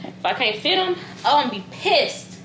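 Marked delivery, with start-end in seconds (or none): none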